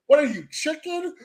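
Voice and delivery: high-pitched movie-quote taunt; Kel Mitchell. Retold or imitated. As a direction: lightly imitate a film character's chicken taunt. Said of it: "Kel Mitchell, it says".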